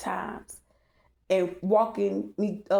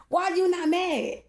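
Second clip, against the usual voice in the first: higher, slow, pointed; incredulous question; 10 semitones higher, slower than usual. Retold or imitated. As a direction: drag the question out at him. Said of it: "But now that I'm awakened".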